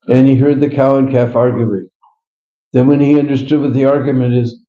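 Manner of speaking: normal talking voice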